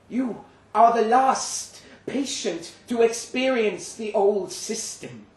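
a high, booming creature chuckling as it explains. Acted. Creature explains hospitals, laughing.

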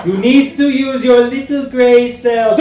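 high, loud put-on Belgian accent, laughing; mock Belgian accent. Writer teasing the editor in a Belgian accent. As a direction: ham up a Belgian detective's accent. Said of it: "Oops, wrong language!".

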